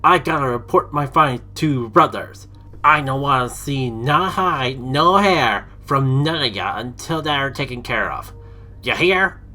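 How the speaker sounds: loud drill-sergeant bellow; hulking boss barking. Acted. Huge leader ordering his minions to find the hero, loud barking. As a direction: bark orders at the troops like a brute. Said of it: "Even a drill sergeant would quiver".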